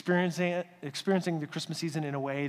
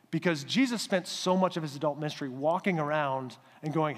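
ordinary delivery

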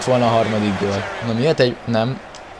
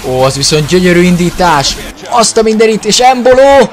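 loud, high live goal call; excited hungarian sports commentary; 7 semitones higher, 6 dB louder than usual. Acted.

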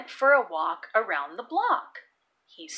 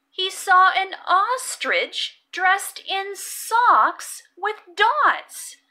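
usual speaking voice

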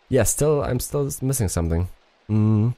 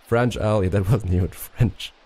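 ordinary talk

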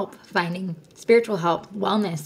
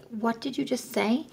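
higher, softer disbelieving question from the husband; husband's startled question, pitched up and quiet; shocked husband; 5 semitones higher, 4 dB quieter than usual. Retold or imitated. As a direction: let him sound taken aback and a little hushed. Husband's stunned reaction retold.

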